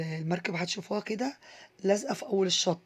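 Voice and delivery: ordinary talk, nothing marked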